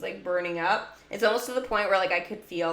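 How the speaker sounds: ordinary talk, nothing marked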